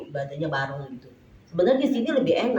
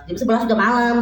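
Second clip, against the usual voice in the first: louder, higher retold retort; scolding mother; 4 semitones higher, 7 dB louder than usual. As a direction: argue back like a fed-up mother. Mother argues back over curfew.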